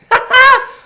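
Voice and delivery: short, loud, high real laugh; burst of laughter. Real, not acted. Doctor laughing at his own bald, beardless filter face, loud laugh. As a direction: cackle at the strange filter.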